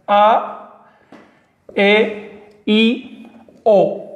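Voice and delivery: each vowel said clearly apart; vowel demo. Shown as a demonstration. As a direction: say A, E, I, O slowly one by one. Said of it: "las podemos escribir bastante decentemente". (vocales del náhuatl pronunciadas una a una)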